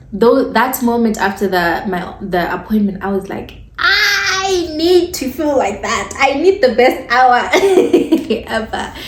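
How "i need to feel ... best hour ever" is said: laughing. Retold or imitated. Client wishing for her best hour, laughing. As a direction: laugh at your own desperation. Quoting her own desperate thought.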